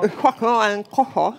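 cork in mouth; talking around a cork; mouth full. Real, not acted. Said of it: "das spricht eher undeutlich".

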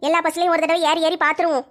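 ordinary delivery

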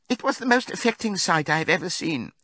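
high-pitched, put-on tearful pig voice; tearful Squealer. A narrator's reading. Squealer announcing Boxer's death, high tearful voice. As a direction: squeal it with a show of grief. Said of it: "said Squealer, lifting his trotter and wiping away a tear".

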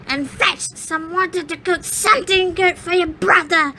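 put-on high, bossy old witch. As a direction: order the girl about, gloating. Witch orders Gretel.

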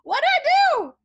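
loud, high-pitched mock wail; hammed-up squawk of innocence; indignant protest. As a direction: yelp it as the wrongly blamed party. Protests at being blamed.

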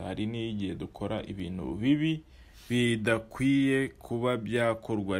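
ordinary talk, nothing marked

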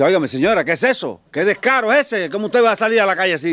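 high, loud indignant scolding; scolding outrage. Acted. Bystander scolds a woman in the street, high indignant outrage. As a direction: scold her, scandalized and rising.

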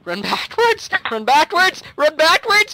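high, loud repeated warning; rising, insistent command; urgent shout. Real, not acted. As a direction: yell it urgently to a teammate. Urgent repeated call to teammate.

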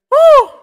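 loud, very high whoop. Real, not acted. Streamer cheering himself, big high whoop. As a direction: let out a wild woo. Excited whoop after story.

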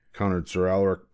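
ordinary delivery, nothing marked

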